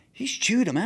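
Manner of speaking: usual speaking voice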